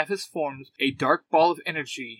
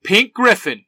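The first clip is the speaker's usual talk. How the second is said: loud, raised-pitch battle cry; high, forceful hero shout; yelled morph call; 6 semitones higher, 9 dB louder than usual. A narrator's reading. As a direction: yell it like a transformation call. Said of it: "Kimberly yells".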